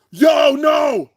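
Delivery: high, loud cry of disbelief; real high-pitched outburst; shocked shout. Real, not acted. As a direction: yell it high in horror. Shouting at shocking battle moment.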